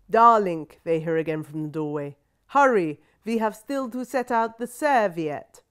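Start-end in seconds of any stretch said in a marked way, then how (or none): 0.1-0.7 put-on much louder grand old lady calling out; loud commanding grandmother. A narrator's reading. Grandmother calling from doorway again. 2.5-5.6 put-on loud, slow, higher grand old lady giving orders; imperious grandmother. A narrator's reading.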